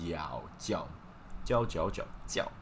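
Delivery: 叫 said with a sharp falling fourth tone; 叫 repeated, falling tone. Shown as a demonstration. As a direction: drop the pitch hard on 叫. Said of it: "So, going down".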